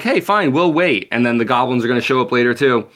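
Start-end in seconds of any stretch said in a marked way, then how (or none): 0.0-1.1 much higher, breezy shrug of a voice; mock-casual wolves. Wolves shrug off the missing goblins, lightly mocking. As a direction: play the wolves lightly agreeing. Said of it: "the wolves are like".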